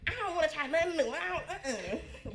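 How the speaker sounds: fast, high, laughing refusal; sister's attitude. Retold or imitated. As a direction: mimic a sassy refusal. She got a little attitude.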